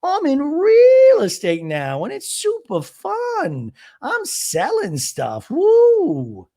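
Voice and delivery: high, slow, bubbly Gina voice with a loud woo; high, slow mock Gina; imitating Gina. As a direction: play Gina all business and chirpy, stretched out. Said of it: "She's like".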